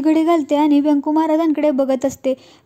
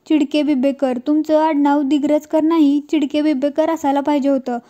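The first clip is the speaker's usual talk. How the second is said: usual speaking voice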